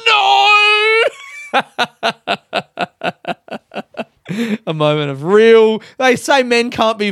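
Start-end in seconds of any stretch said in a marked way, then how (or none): 0.1-1.0 high, loud mock-crying no; wailed no. Answering his mate with a high mock wail. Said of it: "You all right, mate?". 1.1-4.0 laughing. Real, not acted. Laughing at his own wail.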